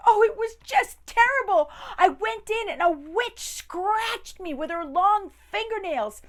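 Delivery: high, loud frightened voice; terrified robber. Acted. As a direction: babble it in a panic. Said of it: "the youngest robber said".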